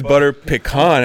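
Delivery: pecan slowly as "pih-KAHN", loud and high; corrected pecan. Retold or imitated. Guest mocks the correct version, pecan as a loud "pih-KAHN". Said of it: "gotta pronounce it correctly".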